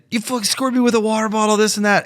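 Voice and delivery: high, fast mock rant; imitated losing it. Squirted player complaining to the bench, mock furious. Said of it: "he just starts losing it".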